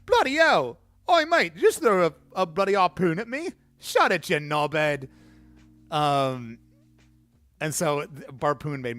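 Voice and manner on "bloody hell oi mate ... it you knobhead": high, loud cartoon British accent, shouting; over-the-top British bloke yelling in outrage. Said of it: "an absolute stereotype of, like, a British character".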